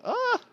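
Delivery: high gasp-like cry, cut short; startled yelp. Real, not acted. Player interrupted mid-plan, high startled yelp. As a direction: break off in a sudden high yelp.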